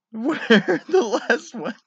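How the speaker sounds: high, loud, slow words through laughter; laughing. Real, not acted. Reading the pizza joke aloud, laughing through it. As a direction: squeeze the question out while cracking up.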